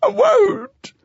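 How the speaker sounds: high, loud, frantic refusal; hysterical cry. Acted. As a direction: shriek it out in panic. Hysterical youth resisting police.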